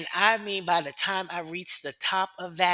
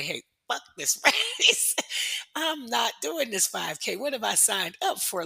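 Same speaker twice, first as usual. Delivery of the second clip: high, laughing, slowed-down exasperation; exasperated inner rant; 7 semitones higher than usual. Retold or imitated. Inner rant at her pregnant friends, laughing and exasperated. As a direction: blurt it out half-laughing, mock fed up. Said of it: "I wanted to say".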